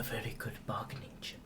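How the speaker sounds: unvoiced whisper for an aside. Real, not acted. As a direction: whisper it out of character. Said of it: "if I whisper, you know I'm in".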